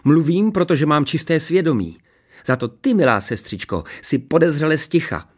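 high, self-righteous retort; snapping back. A narrator's reading. Princess rounding on her silent sister, snappish. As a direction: turn the accusation on her. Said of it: "utrhla se na ní Ksenie".

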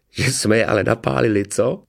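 mildly amused, pleased with the trick; gleeful chortling. A narrator's reading. Boy gloats to his friend about fooled guards, chortling. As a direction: share the joke with your mate. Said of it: "řechtal se Hromádka".

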